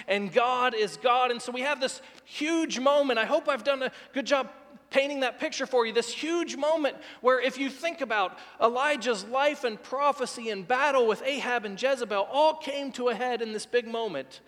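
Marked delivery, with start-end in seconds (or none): none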